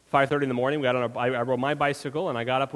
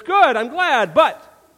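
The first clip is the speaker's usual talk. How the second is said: Jesus voiced; 10 semitones higher, 10 dB louder, much slower than usual. Jesus answering Thomas, voiced higher.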